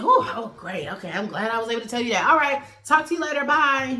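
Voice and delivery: high, loud and fast fake cheer; mock chipper rush-off. Molly hanging up on Jared, high fast mock cheer. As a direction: hurry off the phone all bubbly.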